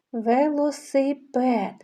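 «Велосипед» drawn out syllable by syllable, «и» sound made clear; slow sounding out. Shown as a demonstration. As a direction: say «Велосипед» slowly for children to hear each sound. Teacher sounding out picture names. (повільно, по складах «Велосипед»)

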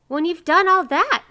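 high, loud, firm answer; emphatic. A narrator's reading. Three neighbors lay down terms to miser, emphatic. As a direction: call it out firmly.

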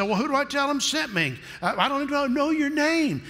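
high, hesitant pleading voice; a nervous man begging off a job; timid Moses. Acted. Moses nervously questioning God, high and stammering.

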